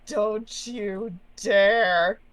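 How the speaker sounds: very slow, stretched threat; long, emphatic DARE; drawn-out warning. Acted. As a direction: draw out each word to warn them off. Player warns off the entity.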